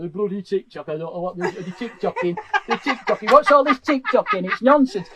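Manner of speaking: mock old-fashioned dad ranting about TikTok; grumpy dad. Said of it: "I feel like that dad when I talk about TikTok".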